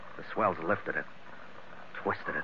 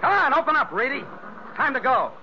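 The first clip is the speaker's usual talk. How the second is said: calling out; 10 semitones higher than usual. Acted. Calling through locked door.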